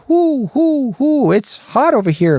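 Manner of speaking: high, slow hooting owl voice complaining of heat. Retold or imitated. Preacher mocks an owl living in eternal fire, high hooting. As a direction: hoot it up high like a sweltering owl. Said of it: "He's going".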